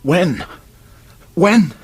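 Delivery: pressing harder each time he asks; urgent repeated question. Acted. A cornered man demands to know when the arrest comes, rising in urgency.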